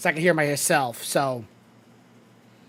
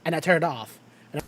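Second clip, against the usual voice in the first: deep voice filter; faster than usual. Shown as a demonstration. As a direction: voice changer, pitched down. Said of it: "the deep voice".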